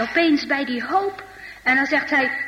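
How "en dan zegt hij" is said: ordinary delivery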